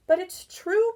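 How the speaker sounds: high, loud insistence; boy insisting to his father. Acted. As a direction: insist hard against disbelief. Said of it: "Barry insisted".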